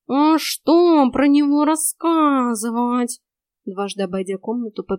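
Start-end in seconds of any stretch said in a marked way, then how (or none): 0.1-3.2 higher, slow, loud cat drawl; talking cat. A narrator's reading. Cat puzzled by the question, drawling. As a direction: draw the words out like a talking cat.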